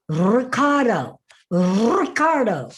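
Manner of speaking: "Ricardo" slowly with a trilled R; rolled R. Shown as a demonstration. Coach showing the rolled R, slow "Ricardo". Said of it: "roll your R's".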